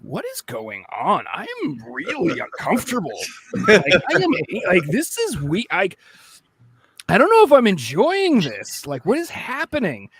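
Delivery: rising, rattled panic; uneasy and scared. Retold or imitated. Kid scared playing horror game.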